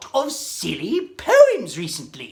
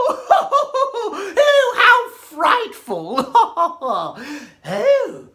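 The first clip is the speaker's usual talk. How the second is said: laughing fright; 11 semitones higher, 5 dB louder than usual. Acted. Mock fright at joke.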